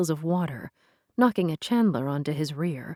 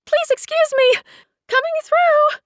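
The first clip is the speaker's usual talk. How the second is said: high, loud yell to clear the way; 10 dB louder than usual. A narrator's reading. Girl yelling at petitioners to let her through, loud. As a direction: shout it over the crowd. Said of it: "she yelled".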